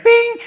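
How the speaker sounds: a very high, loud magic "Bing"; ping it out like a spell. Acted. Fairy magic sound.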